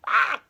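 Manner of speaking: high, loud startled cry; poked child crying out; high yelp. Acted. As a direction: shriek at the jab.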